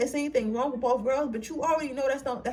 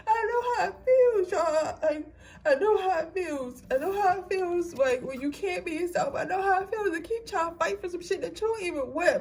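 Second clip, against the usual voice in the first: tearful, higher voice breaking into crying; getting emotional; 4 semitones higher than usual. Real, not acted. Telling viewers she knows how it feels, getting emotional. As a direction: plead through rising real tears. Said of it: "I feel like I'm getting emotional".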